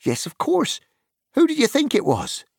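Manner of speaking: very high, breezy hobbit voice; young Merry. A narrator's reading. Merry teasing the startled farmer, high and breezy. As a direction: tease them lightly for the fright.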